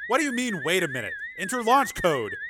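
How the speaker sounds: irritated order. Acted. Commander snaps at stalling crewmate.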